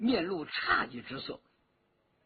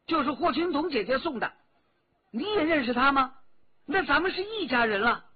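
butting in, unable to hold back, pitch raised; eager interjection; 7 semitones higher, 5 dB louder, faster than usual. A narrator's reading. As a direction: blurt it out, high and loud. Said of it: "再也忍不住了，就插嘴说". (忍不住插嘴，高声急切)

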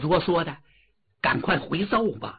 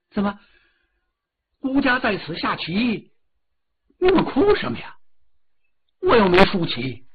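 put-on haughty young prince; 5 semitones higher, 3 dB louder than usual. Prince questions weeping eunuchs.

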